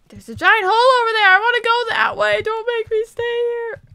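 high whiny protest, stretched out at the end; pleading whine. Real, not acted. Protests at being held back.

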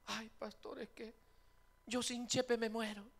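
high whining plea; mock lovesick whine. Woman whining to the pastor about her man, high whine. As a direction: whine to the pastor like she'd die.

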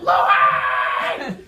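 high, loud, drawn-out yell; alarmed shout. Retold or imitated. Storyteller shouts to Louis after the bite scare, panicked yell. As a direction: yell at your friend in acted panic.

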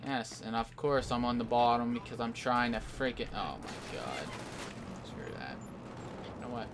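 low, soft, defeated grumbling; resigned disgust. Real, not acted. Player at the bottom of the board, resigned. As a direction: give up, voice dropped right down.

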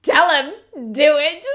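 loud, high cheering-on; emphatic urging. Retold or imitated. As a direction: urge them on in punchy, high, loud bursts. Eager reader urging characters on.

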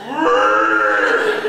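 loud, sustained mechanical whirr; roaring hand dryer. Retold or imitated. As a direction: imitate a deafening dryer motor, full blast. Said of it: "the loudest hand dryer".

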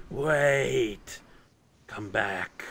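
slow, drawn-out creature voice; drawn-out, eerie plea to return; creature calling out. Acted. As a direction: call them back slowly, lightly in character. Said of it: "hear a voice call out".

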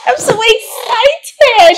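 high, loud and drawn-out thrill; squealed excitement. Acted. New PA celebrating with the boss, squealed excitement. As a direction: burst out in a slow, delighted squeal. Overjoyed at getting PA job.

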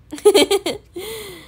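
high little giggle. Real, not acted. Amused after reading out reply.

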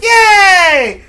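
hammed-up childish yay; mock kids cheering.

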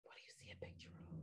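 whispered repeat of the question. Shown as a demonstration. Teacher whispers the question to the students, hushed. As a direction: breathe the question softly to the class.

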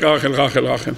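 ordinary talk

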